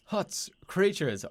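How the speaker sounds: slow, with a voice break; voice crack. Real, not acted. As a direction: voice cracks upward on creatures. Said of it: "That voice crack?".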